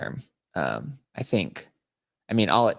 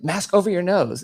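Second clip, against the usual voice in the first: high, louder mock TSA agent; imitated exasperated order; 11 semitones higher, 6 dB louder than usual. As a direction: mimic an official losing patience. Agent's order again, retold.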